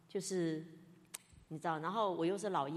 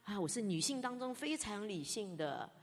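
usual speaking voice

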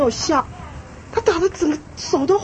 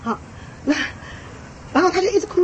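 usual speaking voice

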